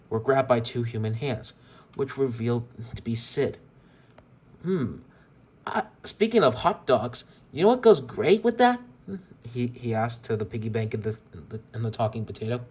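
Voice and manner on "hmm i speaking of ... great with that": put-on sinister kid taunt. Sid taunting the captive toys.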